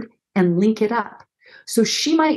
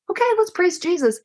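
mock cheerful quip; 10 semitones higher, faster than usual. Quoting hasty comforter.

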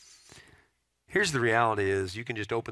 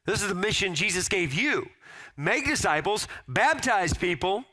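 pitched-up final call to mission; rising sermon climax; 9 semitones higher than usual. Acted. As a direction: lift the voice for the last charge.

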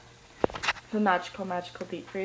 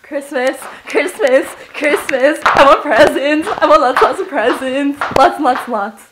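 giddy greed; 4 semitones higher, 8 dB louder than usual. Real, not acted. Vlogger chanting for presents, loud and giddy.

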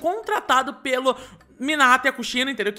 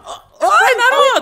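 high, loud call upward; 11 semitones higher, 7 dB louder than usual. Acted. Babysitter calling up to Naruto on the roof, high and loud. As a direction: shout up to the kid on the roof.

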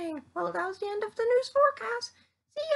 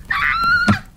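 scream; 12 dB louder than usual. Acted. Someone set on fire.

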